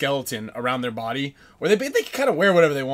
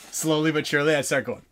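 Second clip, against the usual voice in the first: ordinary talk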